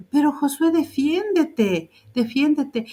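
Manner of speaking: lightly voiced parroting of the teacher; repeating Anita's urging. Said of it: "decía".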